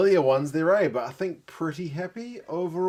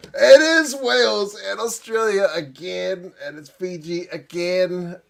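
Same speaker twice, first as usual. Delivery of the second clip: higher, louder, slowed cry of disbelief; exasperated outcry; 4 semitones higher, 7 dB louder, slower than usual. Real, not acted. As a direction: call it out in real exasperation. Groaning at a repeat draw.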